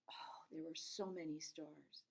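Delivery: slow, hushed whisper; whispered wonder. Acted. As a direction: breathe it out in awe. Narrator marvels at stars.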